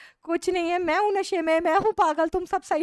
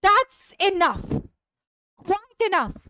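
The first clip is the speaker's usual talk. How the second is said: high, very slow, drawn-out call to halt; firm stop; 7 semitones higher, 4 dB louder, much slower than usual. Acted. Neighbour stops the family quarrel, high and very slow. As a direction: draw out each word to stop the quarrel.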